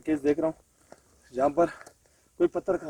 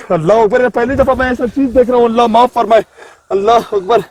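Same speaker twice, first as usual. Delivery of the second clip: louder, higher, astonished cries; shocked exclamations; 7 semitones higher, 12 dB louder than usual. Real, not acted. Seeing stones come from the patient, real loud astonishment. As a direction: exclaim in real disbelief at the sight.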